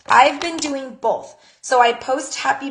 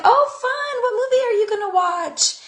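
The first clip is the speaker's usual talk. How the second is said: much higher, chirpy and upbeat; cheerful chat reply; 12 semitones higher than usual. Retold or imitated. Replying to a friend about her birthday plans, chirpy and high. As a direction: sound delighted and interested.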